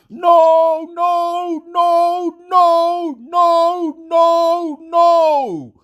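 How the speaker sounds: mother's drawn-out screamed no, high and loud; hammed-up high wail of refusal; retold screaming. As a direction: imitate a mother screaming in protest, very high-pitched. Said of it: "stop screaming".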